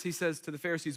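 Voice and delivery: ordinary talk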